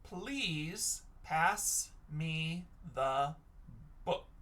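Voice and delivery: very slow, each word apart; slow dictation. Shown as a demonstration. Teacher rereads the sentence to children, slow and spaced out. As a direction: read it back word by word.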